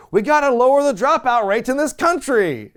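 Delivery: loud, high preacher-style declaration; preachy proclamation. Retold or imitated. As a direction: proclaim it like a sermon. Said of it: "Al Sharpton just goes".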